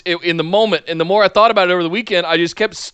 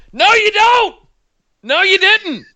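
indignant retort; 13 semitones higher than usual. Real, not acted. Retorting to Cena's quote.

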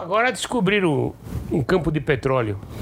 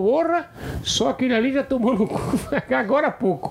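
high, laughing through the line; laughing punchline; 7 semitones higher than usual. Retold or imitated. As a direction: crack up while saying it. Joke punchline, pharmacist exclaims.

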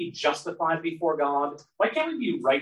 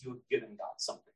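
near whisper; 3 semitones lower, 13 dB quieter, faster than usual. Retold or imitated. Elihu quote, voice drops.

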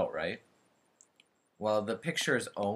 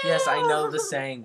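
exasperated; 7 semitones higher, 7 dB louder than usual. Real, not acted. Exasperated at repetition.

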